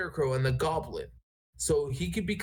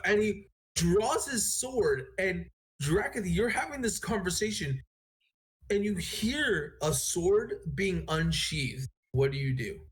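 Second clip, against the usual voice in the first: ordinary delivery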